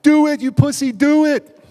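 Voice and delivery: full-force high-pitched scream; imitated screaming goad, very loud; girlfriend yelling. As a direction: shriek it as a taunting dare. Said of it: "my girlfriend's yelling".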